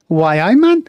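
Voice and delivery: hammed-up Geordie cry; exaggerated Geordie shout; mock Geordie. Player mimics Geordie, louder.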